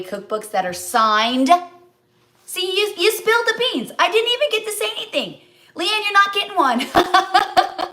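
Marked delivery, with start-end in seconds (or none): 2.5-7.9 mock scolding. Mock scolding friend live.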